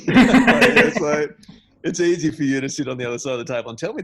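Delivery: high, loud, laughing through the words; incredulous, amused objection; laughing protest. Real, not acted. Laughs while retelling his protest.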